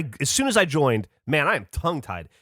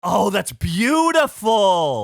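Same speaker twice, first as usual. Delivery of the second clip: delighted; 4 semitones higher, 4 dB louder, much slower than usual. Real, not acted. Delighted at apple idea.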